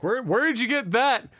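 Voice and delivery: mother demanding. Retold or imitated. Mom confronts kid in story.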